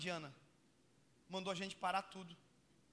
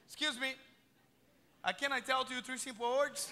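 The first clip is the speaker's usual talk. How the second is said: nervous rushed English; 4 semitones higher, 4 dB louder, slower than usual. Retold or imitated. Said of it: "Falei assim".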